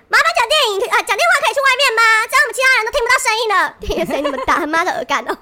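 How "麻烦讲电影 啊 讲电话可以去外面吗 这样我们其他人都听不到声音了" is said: mock righteous lady. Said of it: "正义使者大姐姐就说".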